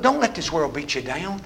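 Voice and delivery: ordinary delivery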